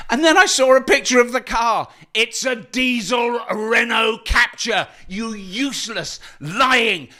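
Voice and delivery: high, exasperated rant building to insults; mock outrage. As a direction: spit out each insult slowly, high-pitched in comic indignation. Ranting at a misleading news story.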